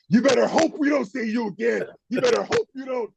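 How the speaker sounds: high, shouted taunt; mock taunting shout. Player taunting the winning team off the field, high shout. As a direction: yell a threat at the rival team. Trash talk walking off field.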